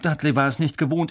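ordinary delivery

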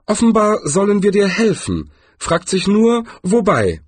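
hohe, lautere Trollstimme; Troll. Acted. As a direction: ein neugieriger Troll fragt nach. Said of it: "fügte der Troll hinzu". (hohe, neugierige Trollstimme)

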